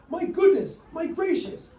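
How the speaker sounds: high amazed exclaim; shouted. Acted. Crowd seeing the elephant bird, high amazed shout. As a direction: gasp it out in high wonder. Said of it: "they shouted".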